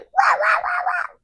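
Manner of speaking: high, yappy dog barks; rapid high-pitched mock barking; imitated dog bark. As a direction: yap like a small dog. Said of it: "and he barks".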